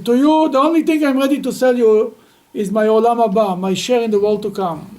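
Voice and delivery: loud, scoffing sales pitch; mocking offer. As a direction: offer it with a sneering grin. Card player offers his afterlife mockingly.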